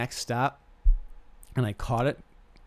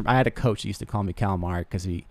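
ordinary talk

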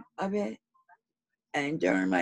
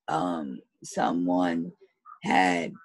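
croaky, cracking sick voice; rough, frog-in-the-throat voice; hoarse voice; 10 semitones lower, slower than usual. Real, not acted. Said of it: "excuse my voice, I sound like a sick dog".

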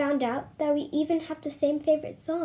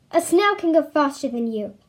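loud jeering shout; shout from the crowd; 3 semitones higher, 8 dB louder than usual. A narrator's reading. A crowd heckler jeering at the runner, loud shout. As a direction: yell it as a taunt from the crowd. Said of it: "I heard a shout from the crowd".

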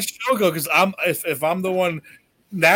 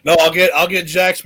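ordinary talk, nothing marked

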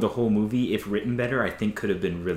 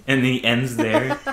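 ordinary talk, nothing marked

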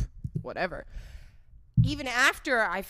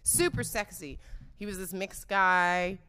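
ordinary talk

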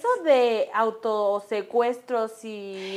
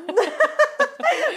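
giggling high; high laugh; 12 semitones higher than usual. Real, not acted. (risa aguda y breve)

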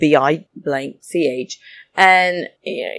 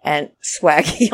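normal talking voice

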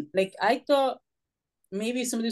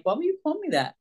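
higher-pitched, mildly indignant question; retold hurt protest; 8 semitones higher than usual. Child confronting kids who called names, mildly hurt. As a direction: ask it as if wronged, pitch jumping up.

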